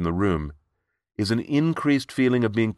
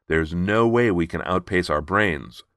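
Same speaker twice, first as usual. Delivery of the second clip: ordinary talk, nothing marked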